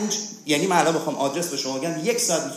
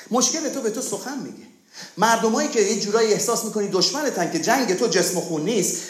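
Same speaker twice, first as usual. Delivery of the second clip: ordinary delivery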